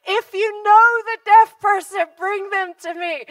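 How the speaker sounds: high, loud call to the crowd. Retold or imitated. Preacher to the crowd, loudly calling out. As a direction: project it over a big crowd.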